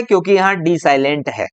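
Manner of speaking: usual speaking voice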